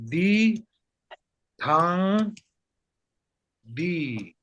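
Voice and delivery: "di, thang, di" with long pauses between words; word-by-word demo. Shown as a demonstration. Teacher shows how to join two things, word by word. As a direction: model "di, thang, di" one word at a time. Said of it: "you can say".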